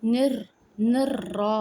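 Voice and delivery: نر را said with a full, heavy ر; teacher showing the heavy ر. Shown as a demonstration. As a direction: voice the ر in را thick and full. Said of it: "تو را کو موٹا پڑھنا ہے".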